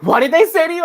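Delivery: high, loud challenge; mock confrontational. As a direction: imitate a partner spoiling for a fight. Partner bristling, ready to argue.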